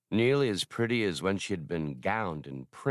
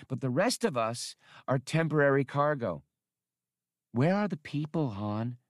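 put-on a young woman's voice, a touch higher, scolding; scolding Hasty; 6 semitones higher than usual. A narrator's reading. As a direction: tell him off, exasperated, pitched up. Hasty cuts him off.

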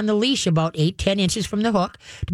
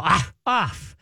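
growl. Shown as a demonstration. Trainer showing owners a growled off command. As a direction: growl the word off at the dog. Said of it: "Give 'em a growl".

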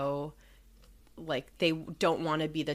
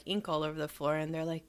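usual speaking voice

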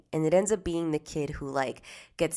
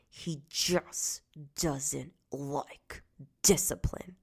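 through gritted teeth; 4 dB quieter, much slower than usual. Retold or imitated. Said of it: "she said it through her teeth".

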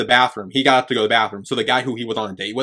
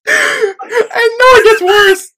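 laughing; 7 dB louder, much slower than usual. Real, not acted. Laughing through embarrassing story.

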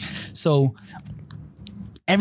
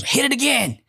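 much higher mom voice; mother's command; 12 semitones higher than usual. Retold or imitated. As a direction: order it like his mother. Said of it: "And she goes".

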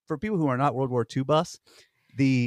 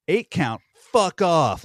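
louder, higher mock brush-off; mock dismissal; 5 semitones higher, 5 dB louder, slower than usual. Hardcore jivers rejecting eight count, mock dismissive. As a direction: dismiss it emphatically, as an imitation. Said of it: "they were like".

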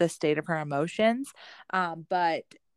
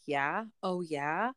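not listening; 6 dB quieter, much slower than usual. Acted. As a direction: answer as if only half listening. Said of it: "if I'm not listening".

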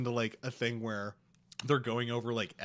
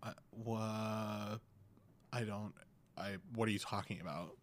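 confused; 8 dB quieter than usual. Retold or imitated. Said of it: "Megan's like".

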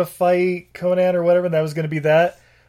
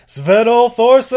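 excited announcement; 7 semitones higher, 7 dB louder, slower than usual. Real, not acted. Hyping a guest actor's name.

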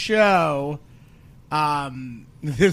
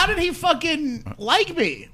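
mock disbelief; 10 semitones higher, slower than usual. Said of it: "I was like".

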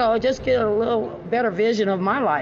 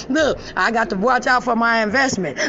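amused teasing with laughter breaking out; real laughter bubbling through the words; laughing. Real, not acted. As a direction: crack up while teasing. Laughing at own joke about watching.

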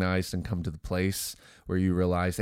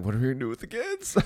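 quick, higher-pitched retold fretting; mock worry; 4 semitones higher, much faster than usual. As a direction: rush it out like a playfully worried parent. Retold dilemma over who minds kids.

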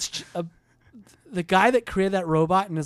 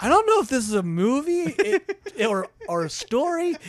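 confused viewer; 8 semitones higher than usual. Retold or imitated. Mock confused viewer quote.

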